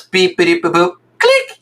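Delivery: high electronic beep-boop with click; vocal beeping, climbing in pitch; imitated beeps. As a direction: mimic a mine's beeps, ending in a high click. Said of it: "like a beep noise".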